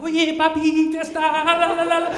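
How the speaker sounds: high, sing-song party call; imitating a merry, high-voiced come-on; happy festive streetwalker. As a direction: trill it like a fiesta welcome. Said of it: "it's very happy and festive".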